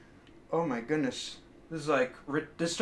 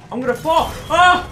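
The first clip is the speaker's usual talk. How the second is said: screaming while falling; 7 semitones higher, 7 dB louder than usual. Real, not acted. Falling off plank.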